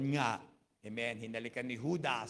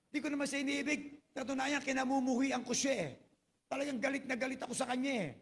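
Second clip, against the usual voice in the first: resentful wife; 11 semitones higher, 4 dB louder than usual. Retold or imitated. Wife tells counselor she hates husband.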